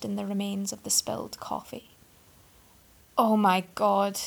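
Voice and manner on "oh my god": blurted. A narrator's reading. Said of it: "he blurted".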